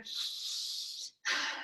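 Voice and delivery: long, breathy sigh of release; a tired child's sigh as the tantrum ends; big relieved sigh. Retold or imitated. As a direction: let it all out in one long sigh. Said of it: "you hear them kinda go".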